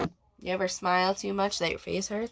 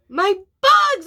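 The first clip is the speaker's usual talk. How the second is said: usual speaking voice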